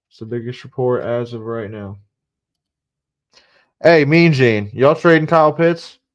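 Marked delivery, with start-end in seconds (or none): 3.8-6.0 loud, higher call across room. Real, not acted. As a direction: shout the question to a friend. Calling out to someone.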